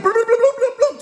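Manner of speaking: high, rapid bloop noises; comic sound effects of a takedown; voiced cartoon scuffle; imitated scuffle noise. Imitates cops tussling with man.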